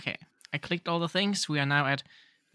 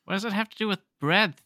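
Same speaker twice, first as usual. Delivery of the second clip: exaggerated question; 6 semitones higher, faster than usual. Mock-insistent repeated question.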